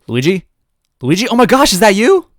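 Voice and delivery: high, loud bubbly exclamation; spunky excited. Acted. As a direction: burst out with bubbly energy. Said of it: "the phone exclaimed with spunky energy".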